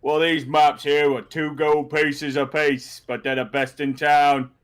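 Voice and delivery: put-on street vendor voice, slow on the price; drawling market seller; second map hawker. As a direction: pitch the goods in a broad hawker's voice. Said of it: "so you see another one".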